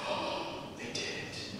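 awed near-whisper; hushed whisper. Retold or imitated. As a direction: breathe it out in awe. Retold awed thought at concert.

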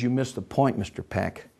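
low, soft, controlled reply; quiet correction. Acted. Boss to protesting Peck, quiet correction. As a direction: drop down and correct him calmly.

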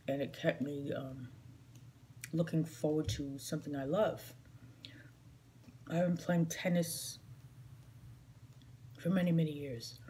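ordinary talk, nothing marked

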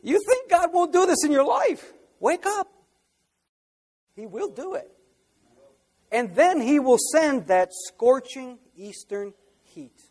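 high-pitched fiery warning; preaching at full force. Real, not acted. Preacher warning the congregation to wake up, fiery. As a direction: wake them up.

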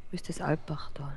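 soft unvoiced whisper. Real, not acted. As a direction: whisper the question. Quiet question during game.

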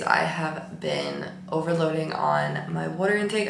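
low, sleepy, drained tired voice. Real, not acted. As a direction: talk like you just woke up exhausted. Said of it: "This is my tired voice".

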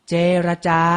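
เจรจา said carefully as je-ra-ja; careful teaching read of เจรจา; pronunciation model. Shown as a demonstration. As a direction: model เจรจา for learners in three clear syllables. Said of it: "อ่านว่า".

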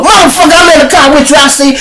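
high, exasperated comeback; mock outraged protest; indignant retort. Friend snaps back in acted exchange.

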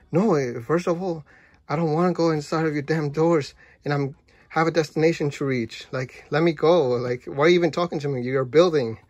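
exasperated shouting. Retold or imitated. Arguing with the talking building.

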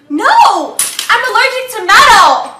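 loud, outraged protest. Acted. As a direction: shout it in outrage. Angry refusal in sketch.

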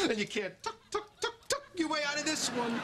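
high clucking taunt; mocking taunt. Man taunts his beaten rival, mocking. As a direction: cluck like a chicken at the loser.

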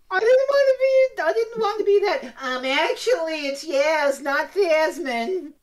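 squeaky high pedantic voice; silly high voice. Pretend. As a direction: whine it like a nitpicker. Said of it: "What the hell was that voice?".

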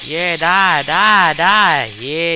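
victorious gamer shouting at his kill; gloating shout. Real, not acted. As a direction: yell it in triumph as you kill. Gloating while killing an enemy.

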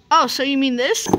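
ordinary talk

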